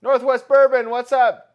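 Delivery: high, loud shout-out to a viewer; hyped viewer shout-out; loud greeting. Real, not acted. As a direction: call out a hello across the room.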